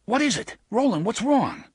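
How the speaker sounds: high, loud cry of alarm; alarmed shout. A narrator's reading. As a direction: call out urgently to a collapsing friend. Eddie rushes to collapsing Roland.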